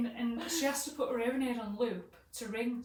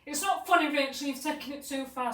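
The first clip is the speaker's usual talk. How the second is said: loud, higher imitation of anger; acted mad scolding; 5 semitones higher, 10 dB louder than usual. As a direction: tell off the prankster in mock fury. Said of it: "she's getting mad".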